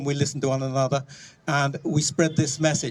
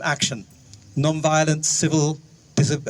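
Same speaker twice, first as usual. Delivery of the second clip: normal talking voice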